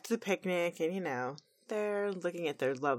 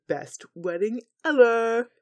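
Rainbow Dash voice; 4 semitones higher, 5 dB louder than usual. Acted. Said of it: "She's like".

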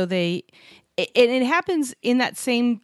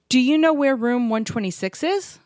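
"Do you know where room one twenty-six is" rising to the end; genuine question; 4 semitones higher than usual. Shown as a demonstration. Asking someone for directions to a room, questioning rise on "is". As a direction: ask it with a clear questioning lift on "is". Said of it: "asking a question".

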